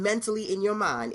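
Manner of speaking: ordinary talk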